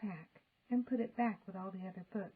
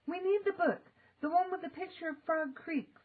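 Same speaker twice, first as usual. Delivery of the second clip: urgent; 9 semitones higher, 4 dB louder than usual. A narrator's reading. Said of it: "said Jack".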